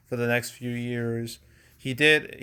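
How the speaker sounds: usual speaking voice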